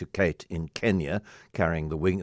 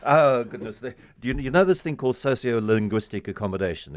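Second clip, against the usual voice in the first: ordinary talk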